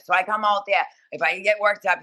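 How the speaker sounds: usual speaking voice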